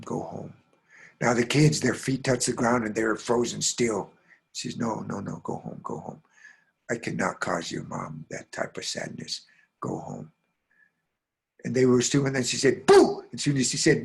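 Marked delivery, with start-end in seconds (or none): 0.1-0.5 soft, low command; gentle release. Acted. Sorrowful ghost releases the children. 4.8-10.3 hushed, very low urging; sorrowful dismissal. Acted. Sorrowful ghost sends children away. 12.9-13.2 sudden loud, high "boo"; startling boo. Acted. Ghost startles frozen children.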